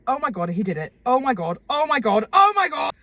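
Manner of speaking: exclaiming, building to a loud high cry; building excitement. Pretend. Reacting to co-host who did it, excitement building.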